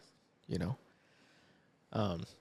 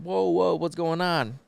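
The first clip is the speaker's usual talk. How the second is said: higher-pitched startled interjection; mock alarm, pitched up; alarmed husband; 6 semitones higher, slower than usual. Husband rolls up on the argument, alarmed.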